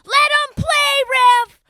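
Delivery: put-on high, drawn-out kid's heckle at full volume; Gabby. Six-year-old heckles the refs, loud high imitated child yell. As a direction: yell it slowly like a tiny outraged fan. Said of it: "the little six-year-old, I believe it was Gabby".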